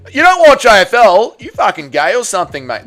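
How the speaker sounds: loud, high mock Aussie bloke jab; mock a footy-mad Victorian mate; typical Victorian bloke. Streamer mocks a Victorian AFL fan, loud mock jab. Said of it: "A typical statement from any Victorian man".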